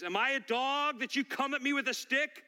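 high, indignant mock roar. Giant insulted by a boy with a stick, roaring. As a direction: roar in outrage at a puny challenger. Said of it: "he roars at him and he says".

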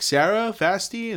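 high retold call up the stairs to roommates. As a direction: call their names upward, mildly. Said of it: "so I called out to them".

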